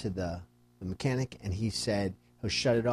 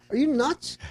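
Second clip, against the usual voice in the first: incredulous; 14 semitones higher than usual. Retold or imitated. Incredulous at buyer's request.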